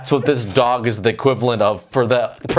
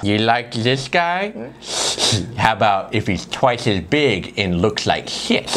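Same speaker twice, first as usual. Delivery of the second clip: mock filmmaker; 3 semitones lower than usual. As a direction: sell it like a smug producer. Mocking the filmmakers' pitch.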